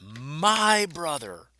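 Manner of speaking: My lifted high and loud, slowly; slow, emphatic My, brother trailing; stress on my. Shown as a demonstration. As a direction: lean hard on My in My brother. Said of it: "this throws the my to the front".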